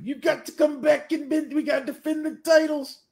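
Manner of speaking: very high, strained wrestler voice; Macho Man. Acted. Comic fan imagining a wrestler urging his partner back, hammed-up wrestler voice. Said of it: "but just hear Macho Man".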